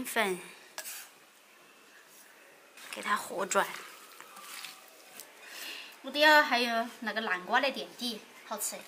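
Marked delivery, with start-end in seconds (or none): none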